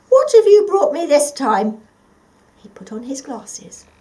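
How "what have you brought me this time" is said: put-on much higher and louder old man greeting; a fond grandpa just woken; waking grandpa. Grandpa wakes and greets the cat, hearty and much louder. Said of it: "said Grandpa".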